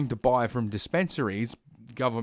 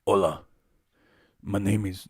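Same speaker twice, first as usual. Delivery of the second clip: South American accent; 4 semitones lower than usual. Shown as a demonstration. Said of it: "South American accent".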